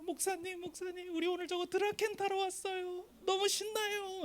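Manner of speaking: put-on high-pitched, giddy girlish squeal; excited young woman. Youngest youth leader begging pastor to ride Draken, excited high voice. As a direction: squeak it like an eager girl stamping her feet. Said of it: "막내 여자 임원이 막 발을 막 동동 구르는 거예요".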